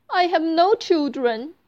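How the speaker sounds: high, tearful plea; mild sob in the voice; sad crying woman. Acted. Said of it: "cries the woman".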